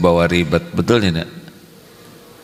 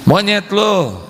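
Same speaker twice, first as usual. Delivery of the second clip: mock insult; 13 semitones higher, much slower than usual. Voicing an insulter.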